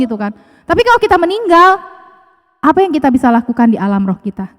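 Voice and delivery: ordinary talk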